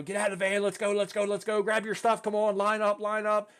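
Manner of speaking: rapid high sergeant bark; retold screaming. Staff sergeant screaming at everybody in the van, mock yelling. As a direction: imitate a drill sergeant rushing recruits out. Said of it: "Screaming at everybody in the van".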